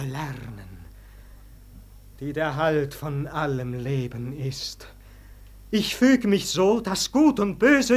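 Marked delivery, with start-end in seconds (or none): 0.0-4.9 whispered, much softer and lower; an awed whisper; hushed whisper. Acted. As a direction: breathe the words like a secret vow. Claudio's hushed vow of faithfulness.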